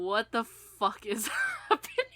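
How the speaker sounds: ordinary talk, nothing marked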